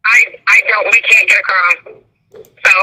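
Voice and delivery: ordinary delivery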